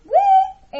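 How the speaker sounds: high loud answering "wee"; chirp. A narrator's reading. Ava answering the bird, high chirp. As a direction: chirp back joyfully. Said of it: "answered Ava".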